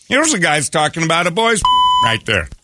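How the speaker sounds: ordinary talk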